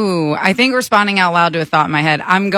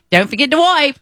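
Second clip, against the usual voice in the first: put-on British-Australian accent; 8 semitones higher than usual. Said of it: "my roommate matches my energy".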